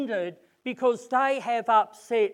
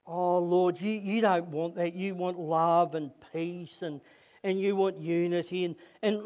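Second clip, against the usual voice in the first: closing prayer; 5 semitones lower than usual. Acted. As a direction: pray gently for the congregation.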